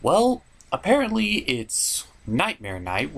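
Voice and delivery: ordinary delivery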